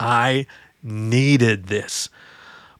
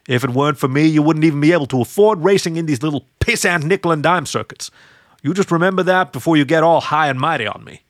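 raised, higher, reproachful; scolding man's voice; 4 semitones higher, 4 dB louder than usual. Acted. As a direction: put her in her place. Rick scolding Cassie.

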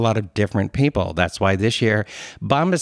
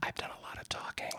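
whispering; 22 dB quieter than usual. Real, not acted. Aside after long ad read.

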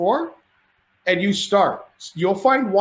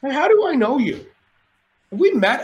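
high-pitched acted bafflement; puzzled recognition; 8 semitones higher than usual. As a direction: try to place a familiar face, mock-confused. Drunk, puzzled at half-recognised stranger.

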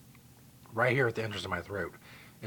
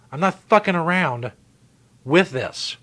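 emphatic loud declaration; 5 semitones higher, 9 dB louder, slower than usual. Real, not acted. Insisting he is serious.

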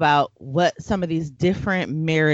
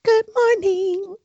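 high, slow, sing-song good morning; high chipper greeting; 14 semitones higher, slower than usual. Shown as a demonstration. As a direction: chirp it way up high. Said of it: "That's how you do it".

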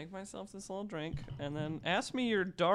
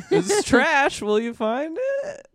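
laughing; 12 semitones higher, 8 dB louder, slower than usual. Real, not acted. Laughing about hidden joke.

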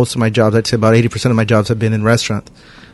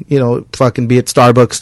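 ordinary delivery

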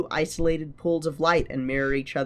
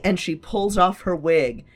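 usual speaking voice

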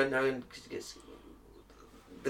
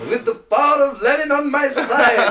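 barbarian wrestler shout; 9 semitones higher, 5 dB louder than usual. Pretend. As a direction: shout it like a Soviet wrestling villain. Soviet wrestler promo.

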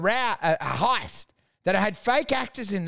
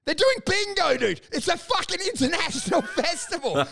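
high, laughing incredulity; laughing disbelief; 10 semitones higher than usual. Real, not acted. Telling his co-host about bingo, laughing disbelief.

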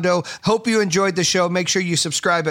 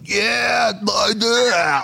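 mock drunk yelling; 4 semitones higher, much slower than usual. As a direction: whoop it like a drunk fan in the front row. Drunk Kevin F-bombing at game.

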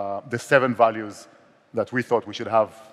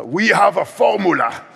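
put-on slow, high, laughing Dutch accent; blunt Dutch boss, half laughing; 8 semitones higher, 6 dB louder, slower than usual. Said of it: "that's my Dutch accent".

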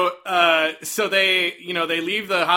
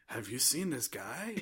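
quiet slow question; 6 semitones lower, 17 dB quieter than usual. Retold or imitated.

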